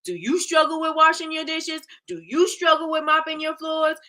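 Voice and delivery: high, hammed-up infomercial pitch; mock a cheesy TV ad host. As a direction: overblown infomercial announcer question. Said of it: "these infomercials".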